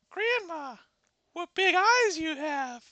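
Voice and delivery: put-on high girlish voice. Red marveling at grandma's eyes, high girl voice. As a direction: innocent little girl noticing.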